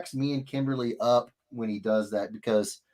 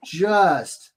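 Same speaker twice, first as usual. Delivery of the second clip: usual speaking voice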